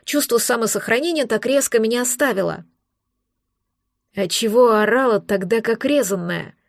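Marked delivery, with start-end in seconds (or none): none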